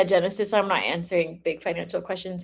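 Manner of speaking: usual speaking voice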